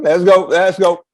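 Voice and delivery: loud, slow let's go; rally everyone to dance; shouting let's go over the music; hype call. Real, not acted. Hyping the room.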